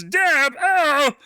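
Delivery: high-pitched comic impression; exaggerated comedian impression, pitched well up; Ray Romano. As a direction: put on a high, whiny sitcom-husband voice. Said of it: "This is a Ray Romano crowd".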